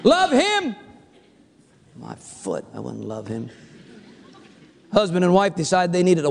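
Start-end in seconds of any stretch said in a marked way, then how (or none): 0.0-0.8 incredulous. Acted. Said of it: "You ever talk like that?". 1.9-3.5 low, soft grumble of refusal; scornful aside, pitched very low; dismissive refusal. Acted. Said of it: "You ever talk like that?".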